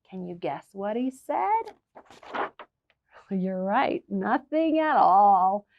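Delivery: ordinary delivery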